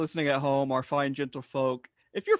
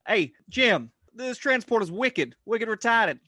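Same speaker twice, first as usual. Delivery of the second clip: hammed-up Boston accent, louder and higher; mock Boston Nimoy calling to Jim; 5 semitones higher, 5 dB louder than usual. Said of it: "he had a thick Boston accent".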